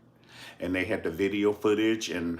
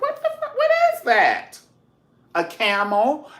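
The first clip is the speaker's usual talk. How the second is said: shrieking high, loud disbelief; sky-high shocked squeal; 8 dB louder than usual. Retold or imitated. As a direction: squeal at the strange animal. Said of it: "I was like".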